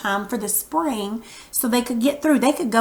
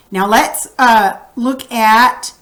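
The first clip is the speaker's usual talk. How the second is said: ordinary talk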